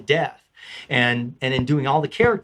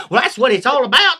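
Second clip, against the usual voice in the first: Southern peasant; 10 semitones higher, 6 dB louder than usual. Acted. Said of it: "I changed it to a Southern".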